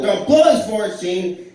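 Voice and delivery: shouted line. Retold or imitated. During the live sketch, shoving Will and shouting the line. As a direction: yell it while shoving him hard. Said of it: "I go".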